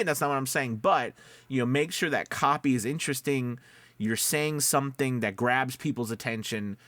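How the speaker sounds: ordinary talk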